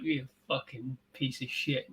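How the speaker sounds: soft, slow muttered curse; defeated muttering. Retold or imitated. Man covered in cereal cursing the rat, soft slow mutter. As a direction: grumble it, beaten down. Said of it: "he sits down and he's like".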